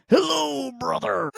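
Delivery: high, theatrical hello brother; character voice. Retold or imitated. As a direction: ham it up like a costumed hero. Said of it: "Dude would not break character".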